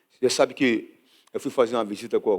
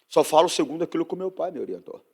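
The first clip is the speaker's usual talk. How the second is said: ordinary talk, nothing marked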